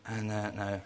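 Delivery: usual speaking voice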